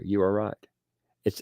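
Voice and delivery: ordinary talk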